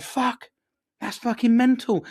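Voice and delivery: high, stunned outburst at the news; retold shocked swear. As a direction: blurt it out, amazed the party animal has kids. Shocked reaction to friend's kids.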